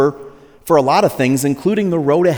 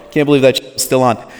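ordinary talk, nothing marked

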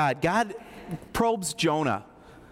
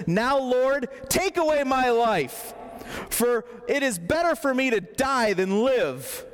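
dramatic Jonah; 7 semitones higher than usual. Acted. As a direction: beg God to end it, hammed-up. Jonah begs to die.

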